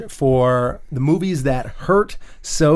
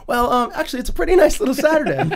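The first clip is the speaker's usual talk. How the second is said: much higher, laughing, cheerful voice; giddy Frank; 5 semitones higher than usual. Retold or imitated. Retelling Frank's weekend plans to friends, high and giggling. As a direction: gush about boring errands like a treat. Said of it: "He goes".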